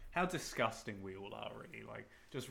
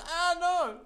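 much higher, louder squeal of a fleeing ghost; ghost's cry; 10 dB louder than usual. Pretend. Cohost voices the Pac-Man ghost fleeing, high squeal. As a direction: shriek like a cartoon ghost running off. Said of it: "it goes".

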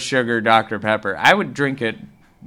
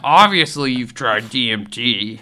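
impersonating Joe Rogan; 3 semitones higher, 6 dB louder than usual. Retold or imitated. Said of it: "He's like".

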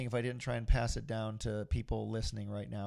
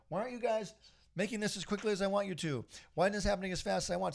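higher, fast impatient whining at the studio; mock flying off the handle, pitched up; impatient demand; 7 semitones higher, faster than usual. To the big corporations, a pitched-up impatient mock rant. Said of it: "What if I had flown off the handle?".

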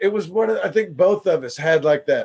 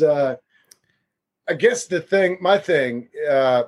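ordinary delivery

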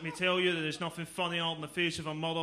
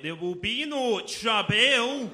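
high, loud, very slow menacing words; slow threatening enunciation; 7 semitones higher, 4 dB louder, slower than usual. Pretend. Glaswegian reassuring the scared Englishman, slow loud menace. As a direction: spell out each word like a threat.

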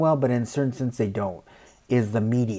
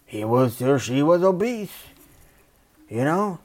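mocking quote; 3 semitones higher than usual. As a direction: sneer out the excuse, pitched up. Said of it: "we're like".

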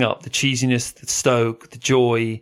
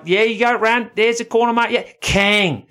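high-pitched Australian surf coach; put-on, higher Aussie coaching call; Aussie accent; 9 semitones higher, 4 dB louder than usual. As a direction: cheer on a kid in Aussie. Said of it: "I'm gonna do my best Aussie accent".

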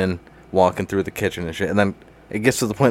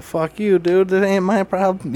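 high, drawn-out mocking protest; mock defiance; 11 semitones higher, slower than usual. Partygoer refusing to be shooed out, mock defiant.

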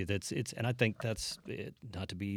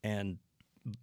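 ordinary talk

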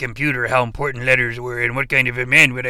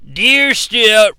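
suddenly loud, higher letter reading; 10 semitones higher, 11 dB louder than usual. Acted. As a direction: blurt the letter opening out. Reading letter aloud, startled.

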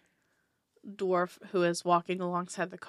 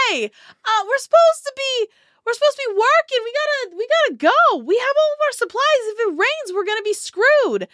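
far higher, louder nagging voice; impatient woman; 10 dB louder than usual. Acted. Nas urges the dwarf to leave before rain, high and loud. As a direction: push him to get moving. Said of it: "you hear the woman, Nas, like".

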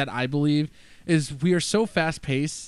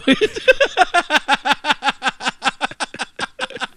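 long high laugh; 12 semitones higher, 6 dB louder than usual. Real, not acted. Laughing at joke.

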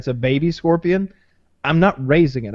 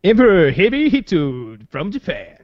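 put-on Japanese accent; 3 semitones higher, 3 dB louder, slower than usual. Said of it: "you just gotta be the, the Japanese guy".

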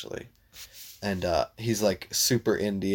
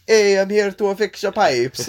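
much higher, louder, slower Italian plumber voice; Italian plumber accent; 9 semitones higher, 8 dB louder than usual. Retold or imitated. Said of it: "Like a hardcore".

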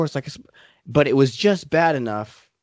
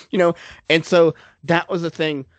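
usual speaking voice

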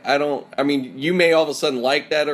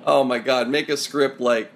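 ordinary talk

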